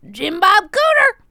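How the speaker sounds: put-on loud Southern accent. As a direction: holler it country style. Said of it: "Southern Talk".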